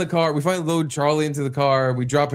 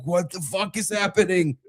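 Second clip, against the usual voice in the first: bewildered despair; 6 semitones higher than usual. Retold or imitated. Husband retelling standing over the toilet, in strained despair. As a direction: act baffled and desperate.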